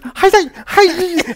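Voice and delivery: very high, loud mock girl; little girl voice. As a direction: squeak it like a tiny girl. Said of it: "the little girl's like".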